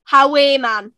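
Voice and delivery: "How are you, man?" as Geordie "ha-WAY man"; Geordie demo. Shown as a demonstration. Teaching a Geordie phrase, "How are you, man?" said as "howay man". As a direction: stress the long middle "are" like "way", then drop on "man".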